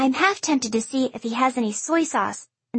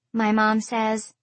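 ordinary delivery, nothing marked

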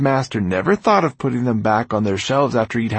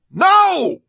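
high, full-voiced shout of refusal; furious high-pitched yell; loud yell; 8 dB louder than usual. A narrator's reading. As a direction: yell it through the house. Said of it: "Orpheus had yelled so loudly".

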